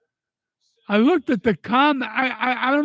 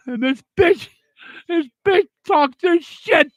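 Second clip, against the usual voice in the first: real, raw anger boiling over, louder and higher; furious; 7 semitones higher, 4 dB louder than usual. Real, not acted. Grieving widower raging at the woman who insulted his family, furious and loud. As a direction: spit out the insult in genuine fury. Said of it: "That's how pissed I am".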